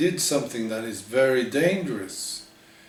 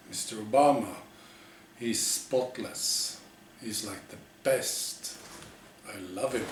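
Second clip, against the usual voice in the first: adoring softness; 5 dB quieter than usual. Acted. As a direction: gush softly over him. Adoring praise.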